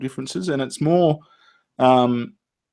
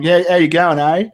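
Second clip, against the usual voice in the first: put-on Queensland accent; 5 semitones higher, 5 dB louder than usual. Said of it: "they'll say "A" all the time".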